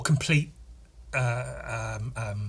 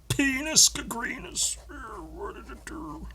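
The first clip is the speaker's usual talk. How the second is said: squeaky silly voice; 12 semitones higher, 4 dB quieter than usual. Pretend.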